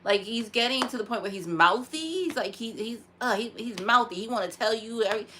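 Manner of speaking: usual speaking voice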